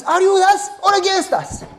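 high loud demand; challenging Joshua. Acted. Joshua demands of the stranger, high and loud. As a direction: confront the stranger sword in hand.

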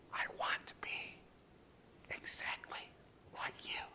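voiceless, very soft confession; whisper. Retold or imitated. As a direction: whisper it deliberately, word by word. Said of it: "I would like him to whisper to me".

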